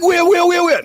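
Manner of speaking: very high, frantic repeated question; rapid-fire squeal of comic alarm; mock panic. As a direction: act out frantically searching in a fright. Acts own panicked reply.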